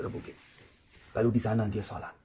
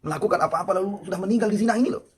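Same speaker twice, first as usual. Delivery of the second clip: loud, high impassioned sermon; preacher heating up over the sinner's deed; fiery preaching; 10 semitones higher, 7 dB louder than usual. Acted. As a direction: preach it out at full pitch. Preacher rising to full force.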